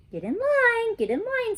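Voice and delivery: high, sing-song teacher call. Acted. Teacher calls class to line.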